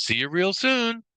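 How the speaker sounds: high, slow sing-out sign-off; cartoon mouse farewell, pitch climbing high; Mickey Mouse. Trainer quoting the cartoon's sign-off, high cartoon voice. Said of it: "His sentence that we heard for years is".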